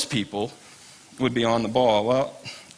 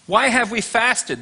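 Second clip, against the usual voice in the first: "Why" raised high and slow, a whiny protest; mock indignant people; 9 semitones higher, slower than usual. Fasting people complain to God, high and whiny. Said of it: "they say".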